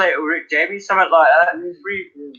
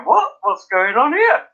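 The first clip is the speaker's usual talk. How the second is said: higher, mock-baffled questioning; imitated puzzlement; 8 semitones higher than usual. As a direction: mimic neighbours wondering what they missed. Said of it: "could hear them all sitting there".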